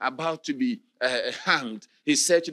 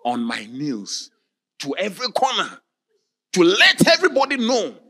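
building fervour; 2 semitones higher, 5 dB louder than usual. Retold or imitated. Condemned man vowing to crawl on his knees, rising to a shout.

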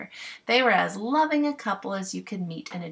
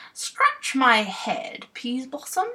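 very slow, louder bumpkin order; slow pompous clown; 6 semitones higher, 4 dB louder, slower than usual. Acted. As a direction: drawl the order like a proud clown.

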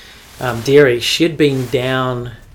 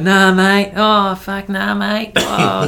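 higher-pitched, hammed-up Aussie accent; fake Australian accent; 8 semitones higher, 6 dB louder, much slower than usual. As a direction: Kiwi pretending to be an Aussie bloke. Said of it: "you'd say you're Australian".

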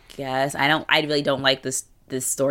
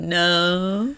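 mock denial; 3 semitones higher than usual. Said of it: "And it's like, oh".

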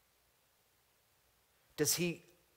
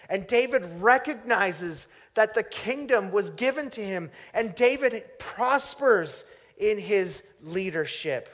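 louder and higher, driving the contrast home; preaching at full force; 6 semitones higher, 10 dB louder than usual. Acted. Preacher pressing home David against Nebuchadnezzar, loud and driving. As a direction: punch the contrast between the two kings.

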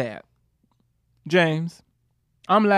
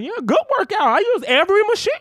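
very high, sweetly lying to mom; teen lying to mom about the gym, high and breezy, laughing; fake innocent; 12 semitones higher than usual. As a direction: chirp the cover story to mom. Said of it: "I'm like".

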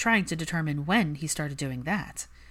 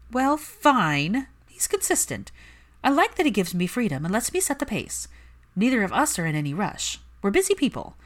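flustered; 6 semitones higher, 3 dB louder than usual. Acted. Pansy flustered, admitting a crush.

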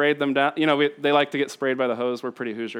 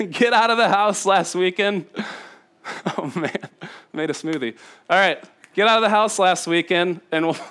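normal talking voice

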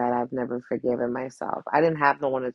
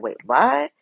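imitating a startled, higher, louder "wait, what"; mock surprise; 8 semitones higher, 5 dB louder than usual. The other person, caught off guard, mock surprised. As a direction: play their baffled double take. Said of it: "And they're like".

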